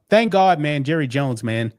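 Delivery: normal talking voice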